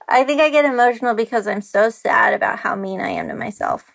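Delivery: real welling-up, with a small laugh; laughing a little while close to tears; getting emotional. Real, not acted. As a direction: hurry the words out through rising emotion. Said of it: "now I'm gonna get emotional".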